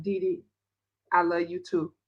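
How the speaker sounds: low, soft acted answer; subdued reply. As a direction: drop right down after the chatter. Acting out own subdued reply to sister.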